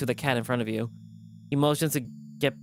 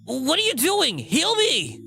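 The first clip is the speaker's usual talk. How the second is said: high, loud demand; demanding yell; 13 semitones higher, 3 dB louder, slower than usual. Acted. Wounded cat yells at the healer, demanding. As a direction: snap at them to heal you.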